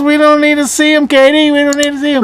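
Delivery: high, urgent protest; mock pleading. Host mockingly begs guest to stop.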